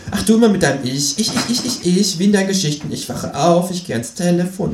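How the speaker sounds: high, nagging creature voice; high exasperated kangaroo jibe; mocking kangaroo. A narrator's reading. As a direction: mimic the self-absorption high and scornfully.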